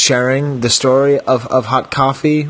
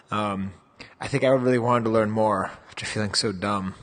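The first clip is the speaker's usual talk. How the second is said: softer, close recorded aside; quiet editor voiceover; 7 dB quieter than usual. Real, not acted. Editor speaks to listeners in a quiet voiceover. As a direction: talk it in quietly like a dropped-in note.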